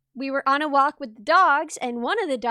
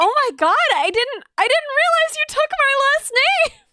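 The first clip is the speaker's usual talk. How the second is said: loud squeal climbing high; overwhelmed, touched pet-owner gush; acted gushing; 10 semitones higher, 5 dB louder than usual. Acts own gushing reaction to sticker.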